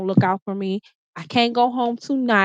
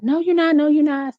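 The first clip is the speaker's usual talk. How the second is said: higher mock insistence, imitating him; boyfriend refusing; 6 semitones higher than usual. Boyfriend telling her she's not going home, higher insistent mock. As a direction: voice him blocking her, pitched up. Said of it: "He's like".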